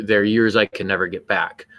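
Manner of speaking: normal talking voice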